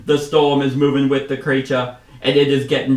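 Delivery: put-on inconsistent accent, swapping Australian for British; loud, badly held British-Australian accent. Commander warning hunters of the storm, slipping put-on accent. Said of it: "swapping from, like, Australian to British".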